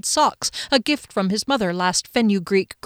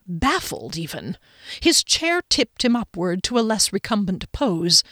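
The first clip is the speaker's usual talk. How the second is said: ordinary delivery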